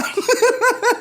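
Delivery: a burst of high laughter. Real, not acted. Comic laughing at his own jail story, high burst of laughter. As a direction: crack up at your own line.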